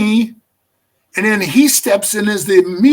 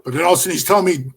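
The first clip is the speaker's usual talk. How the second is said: ordinary talk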